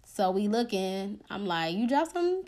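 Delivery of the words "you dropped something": soft, higher-pitched retold question; puzzled aside. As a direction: quietly check with a companion, puzzled. Puzzled murmured question to cousin.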